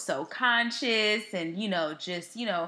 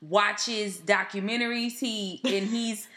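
ordinary talk, nothing marked

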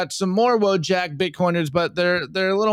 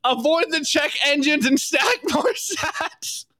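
defiant check engine light; 11 semitones higher than usual. Acted. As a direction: yell it like a stubborn rebel. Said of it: "check engine light goes".

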